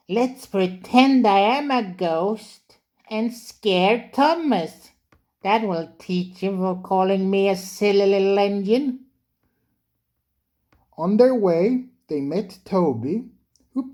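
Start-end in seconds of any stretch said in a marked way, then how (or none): 0.1-9.1 high, mischievous little engine; Percy voice. A narrator's reading. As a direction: plot the prank gleefully. Percy's character voice.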